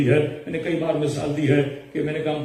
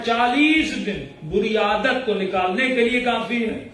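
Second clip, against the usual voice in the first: higher, rhythmic preaching cadence; full-force preaching; 7 semitones higher than usual. Acted. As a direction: drive each point home from the pulpit. Preaching the forty-day rule.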